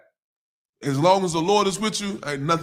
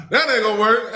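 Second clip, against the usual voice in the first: laughing through the words, higher and louder; 5 semitones higher, 5 dB louder than usual. Real, not acted. Pastor repeating the wife's line to viewers, laughing. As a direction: repeat it while cracking up. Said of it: "that's so funny to me".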